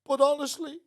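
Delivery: high, laughing through tears; tearful laughing Claire. Retold or imitated. Claire teasing Pete at dinner, laughing through tears. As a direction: voice Claire laughing and crying at once. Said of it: "Claire is crying her eyes out".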